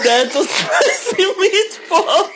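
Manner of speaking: high, slow hammed-up Italian accent; mock Italian chef voice, laughing; a comic Italian accent. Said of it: "You cannot stay in the character".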